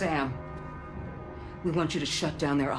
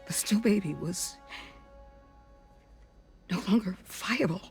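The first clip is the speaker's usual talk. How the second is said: higher, halting, full of regret; grieving confession; 7 semitones higher, 4 dB quieter than usual. Acted. Doctor recalling a baby too late to save, grieving.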